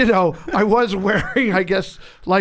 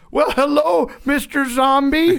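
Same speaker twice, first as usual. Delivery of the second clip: bright, high, louder hello, slowed on the name; buoyant greeting; 10 semitones higher, 7 dB louder, slower than usual. Retold or imitated. Friend greeting the long-haired guy, buoyant and high. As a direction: greet him like a delighted fan. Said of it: "immediately buoyant".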